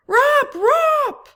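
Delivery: very high, very loud yelped alarm cry; monkey alarm call. Acted. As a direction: yelp it like a monkey sounding the alarm. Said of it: "I yell".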